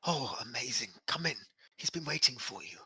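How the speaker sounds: put-on soft, high, frail old servant voice; timid elderly butler, hushed.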